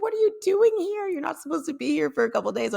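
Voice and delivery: high, incredulous squeal easing down; astonished mother. Retold or imitated. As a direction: imitate a mom who can't believe it. Acting out her startled mother.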